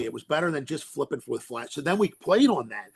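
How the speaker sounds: usual speaking voice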